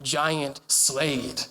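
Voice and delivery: offhand bored dismissal; dismissive mock. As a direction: say it like a bored summary. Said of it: "be like".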